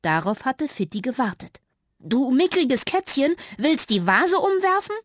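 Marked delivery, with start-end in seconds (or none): 2.0-5.0 a bold little mouse mocking the cat; cheeky. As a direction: taunt the cat to make it angry. Mouse keeps taunting the cat.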